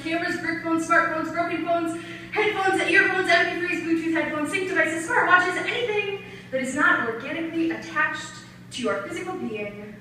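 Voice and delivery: performed slam-poetry inventory; spoken-word poem. Acted. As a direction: rattle off the items like strict orders.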